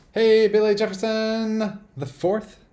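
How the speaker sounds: loud, high, drawn-out hail; stretched-out, booming welcome; hearty called-out greeting. Real, not acted. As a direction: sing out a big hello to a viewer. Greeting a viewer with a big call.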